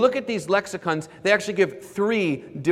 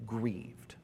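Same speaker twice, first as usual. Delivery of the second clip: whispered; 6 semitones lower, 14 dB quieter than usual. Shown as a demonstration. Whispered repeat.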